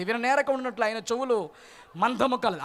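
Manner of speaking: normal talking voice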